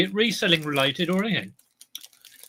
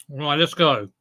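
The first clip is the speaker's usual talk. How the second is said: usual speaking voice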